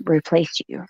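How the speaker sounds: usual speaking voice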